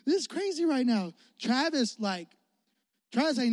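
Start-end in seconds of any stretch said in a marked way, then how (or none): 0.1-1.1 amazed. Retold or imitated. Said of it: "I'm like".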